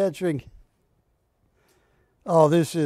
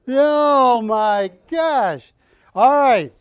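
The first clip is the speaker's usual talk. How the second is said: exasperated; 4 semitones higher, 8 dB louder, much slower than usual. Real, not acted. Exasperated at price chaos.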